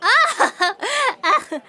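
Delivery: bright flirty laugh; flirty giggle. Acted. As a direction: giggle at the cop.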